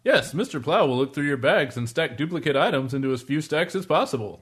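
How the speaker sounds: stagey infomercial pitchman; mock product announcer; spoof ad read. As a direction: sell it like a corny commercial.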